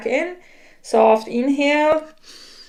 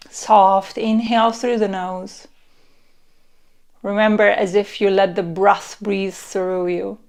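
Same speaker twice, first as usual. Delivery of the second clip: guided meditation; 2 semitones lower than usual. Acted. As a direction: lull them into relaxing.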